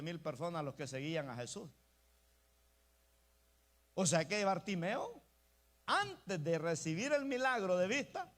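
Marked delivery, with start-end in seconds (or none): none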